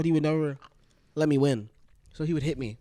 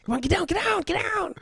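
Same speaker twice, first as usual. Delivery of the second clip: much higher urgent get down; urgent high hush. Retold or imitated. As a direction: hiss it high and tense, hiding. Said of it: "be like".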